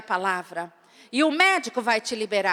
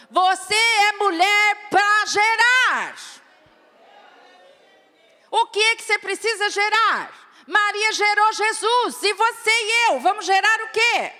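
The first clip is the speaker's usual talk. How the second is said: high, loud, driving sermon voice; fiery preaching; 7 semitones higher, 3 dB louder than usual. Real, not acted. Preacher rousing the congregation about bearing fruit, fiery. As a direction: preach it at full force to the women.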